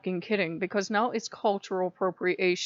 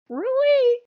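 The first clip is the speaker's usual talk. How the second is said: much higher, louder disbelief; incredulous really; 8 dB louder than usual. Real, not acted. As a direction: fling out the word in disbelief. Ranting about dogpiling over Ganesh.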